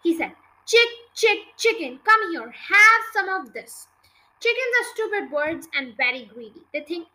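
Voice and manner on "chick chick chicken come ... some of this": higher, loud coaxing call; punchy, raised chick-chick call; calling a chicken. Acted. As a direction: call the hen over to feed. Boy coaxing a hen to come.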